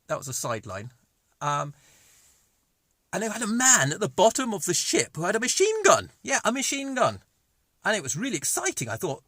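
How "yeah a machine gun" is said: ordinary talk, nothing marked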